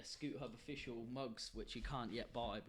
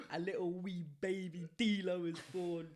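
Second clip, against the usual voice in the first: mock documentary intro; 4 semitones higher, 6 dB louder than usual. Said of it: "Take me through it".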